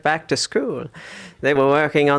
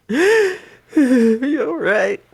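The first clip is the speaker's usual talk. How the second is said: crying; 6 semitones higher than usual. Acted. Grieving mother breaks down.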